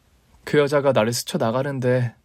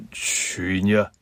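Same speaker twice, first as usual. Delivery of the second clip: hesitant father; 5 semitones lower, slower than usual. Acted. Father on phone.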